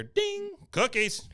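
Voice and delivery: very high ding, then high call; sing-song chime imitation. Host mimics the after-credits gag, high and chirpy. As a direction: chirp it like an oven timer.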